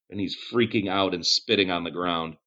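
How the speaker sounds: ordinary delivery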